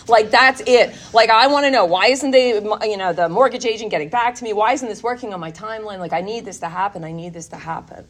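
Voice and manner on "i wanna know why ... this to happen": usual speaking voice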